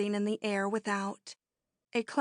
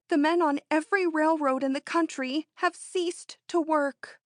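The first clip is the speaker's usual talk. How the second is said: excited; 7 semitones higher, 4 dB louder than usual. Acted. Breathless news announcement.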